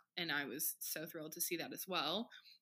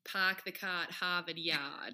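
slow, put-on Boston accent; slower than usual. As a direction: drop the r's like a Bostonian. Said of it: "Do your best Boston accent".

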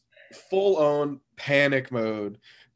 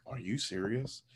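1 shocked quiet aside; 10 dB quieter than usual. Retold or imitated. Wife's shocked whisper aside.

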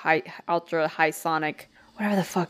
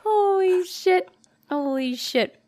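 high, slow holy shit; drawn out in disbelief; stunned; 13 semitones higher, slower than usual. Real, not acted. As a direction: squeal it out, amazed. Reacting to funny story.

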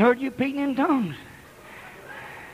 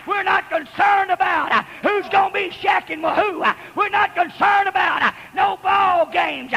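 preaching at full force; 5 semitones higher than usual. Real, not acted. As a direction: whip the congregation up, drawing out each "get lost". Preacher against television.

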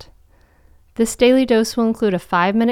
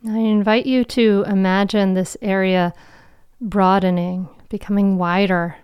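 guided meditation. Acted. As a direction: guide them into calm, with long pauses.

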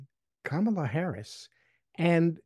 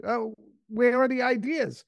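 higher-pitched, badly faked Danish accent; puzzled foreign visitor in a poorly imitated Danish accent; 7 semitones higher than usual. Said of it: "I'll do a very bad accent".

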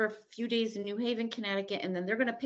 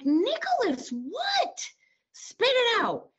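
much higher, louder, impatient mother; exasperated demand; 4 dB louder, much slower than usual. Retold or imitated. A mother pressing her crying son, high and sharp. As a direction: make her son spit it out, now.

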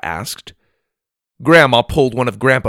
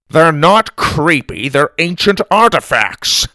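loud, raised, indignant rebuttal; offended correction; 7 semitones higher, 5 dB louder than usual. A narrator's reading. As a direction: defend your treasures hotly. Grandpa bickers back, offended.

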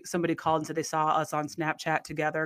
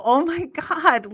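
stunned oh my God; 9 semitones higher, 5 dB louder, much slower than usual. Retold or imitated. Reaction to the revelation.

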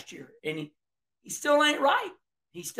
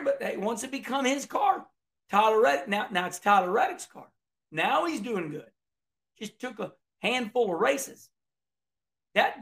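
ordinary talk

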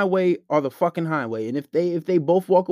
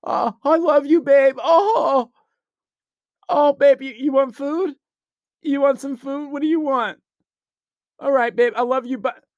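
put-on higher, loud gushing boyfriend voice, laughing; mock simp; 6 semitones higher, 3 dB louder than usual. Mocking a boyfriend begging his girl, high gushing voice. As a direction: fawn over her like a pushover. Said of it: "and then you wonder why".

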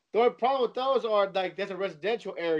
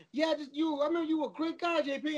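higher, sugary sales voice, lightly put on; butter him up in a higher voice; mock flattering caller; 7 semitones higher, 5 dB quieter than usual. Trent sweet-talking J P on the phone, mock flattery.

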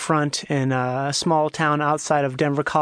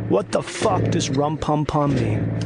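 slowed, lowered voice effect; 3 semitones lower, slower than usual. Shown as a demonstration. Asking about the carol in a slowed-down drag. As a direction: drag it out slow and low. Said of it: "How slow can we get this?".

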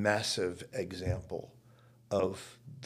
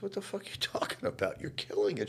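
incredulous; 10 semitones higher, 4 dB quieter, faster than usual. Retold or imitated. Young actor protesting her doubt.

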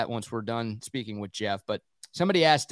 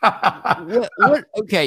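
laughing disbelief; 7 semitones higher, 7 dB louder, much slower than usual. Real, not acted. Laughing disbelief at straight skating.

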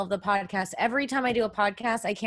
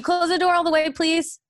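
raised, higher-pitched request; real louder instruction to mom; calling out; 8 semitones higher, 4 dB louder than usual. Real, not acted. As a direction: call after someone leaving the room. Calls to mom leaving room.

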